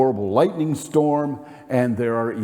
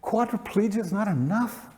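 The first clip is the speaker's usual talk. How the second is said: bewildered lament; 8 semitones higher than usual. Retold or imitated. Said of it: "And I said".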